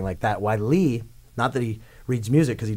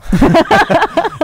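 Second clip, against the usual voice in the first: a sudden high burst of laughter, much louder; 12 dB louder than usual. Real, not acted. Guest bursting out laughing while telling the host his story, loud high laugh. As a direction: cackle out loud at your own story.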